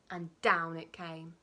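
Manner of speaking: ordinary delivery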